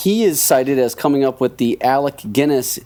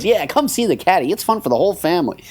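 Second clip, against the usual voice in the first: higher, nerdy movie pitch; Jerry Lewis promo voice; 5 semitones higher than usual. Retold or imitated. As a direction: sell it like a goofy comic on radio. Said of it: "doing his Jerry Lewis nerdy thing".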